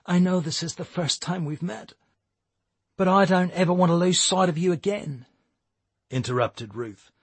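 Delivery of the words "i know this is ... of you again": film hero quote. Retold or imitated. Ruth quoting film line.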